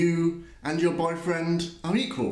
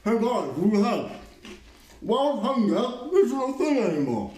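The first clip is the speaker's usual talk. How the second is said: mocking parody voice. S1 mocks with a parody voice.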